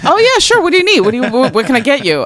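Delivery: high, loud, over-eager offer of help; hammed-up, high-pitched eagerness; eager to please. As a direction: gush like a kid desperate to win Mom over. Acting her eager-to-please younger self.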